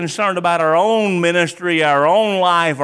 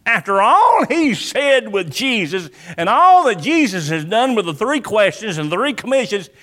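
usual speaking voice

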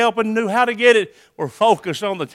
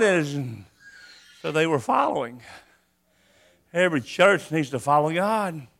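ordinary delivery

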